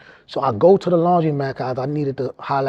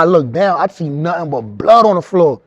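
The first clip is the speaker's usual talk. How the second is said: ordinary talk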